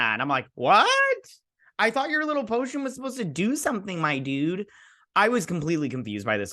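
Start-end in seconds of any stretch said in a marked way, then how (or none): 0.5-4.7 mock disbelief. Retelling her own reaction to show.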